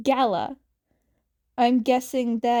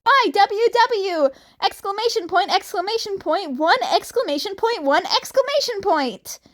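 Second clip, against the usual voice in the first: excited texting; 7 semitones higher, 4 dB louder, faster than usual. Acted. Spelling out an excited text.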